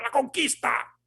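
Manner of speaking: high, shouted cry of conquest; triumphant war cry. Retold or imitated. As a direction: shout it like a mob claiming the land. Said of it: "dijeron una sola palabra".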